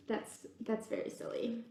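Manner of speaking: soft breathy aside; amused whisper. Real, not acted. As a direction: whisper it like a secret joke.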